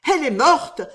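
high, loud cry of alarm; terrified cry. A narrator's reading. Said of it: "s'écria Marie". (cri de terreur, aigu et fort)

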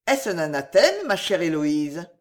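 ordinary delivery

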